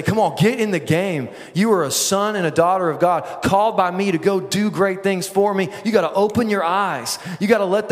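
lightly voiced coach. The Lord rallies a player during a game, lightly voiced coach. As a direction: fire up a player, lightly acted. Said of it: "grab us by the face mask".

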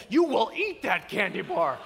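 loud, forceful command; acted exasperated insistence; stern dad order. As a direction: lay down the law to a stalling kid. Dad ordering son to finish.

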